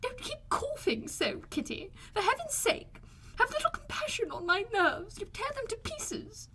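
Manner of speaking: high, slow, fretful scolding; scolding mother. A narrator's reading. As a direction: whine about her nerves, pitched high and slow. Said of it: "began scolding one of her daughters".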